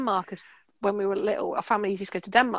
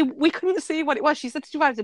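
ordinary delivery

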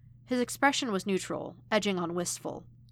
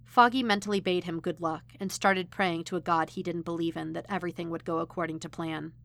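ordinary delivery

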